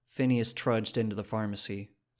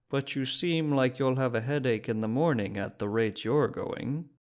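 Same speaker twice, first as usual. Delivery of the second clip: put-on shopkeeper with a thick Czech accent, raised and louder; dense Czech accent; 2 semitones higher, 3 dB louder than usual. Prague shopkeeper teasing a drunk tourist, in a thick Czech accent. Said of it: "said in a dense accent".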